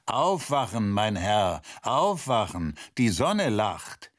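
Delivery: ordinary delivery, nothing marked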